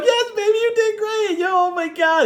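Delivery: very high-pitched, overjoyed proud dad; loud, high, choked-up cheering; fighting back tears. Retold or imitated. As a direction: reenact a tearful squeal of praise. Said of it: "I'm fighting back the tears".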